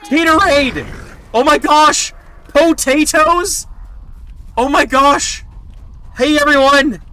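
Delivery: high, loud squeals of disbelief; real shock at a huge raid, yelling it out; loud overjoyed streamer; thrilled shouting. Real, not acted.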